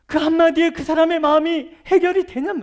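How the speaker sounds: preacher shouting at a one-word apology; indignant shout. Real, not acted. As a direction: hurl the question at the crowd.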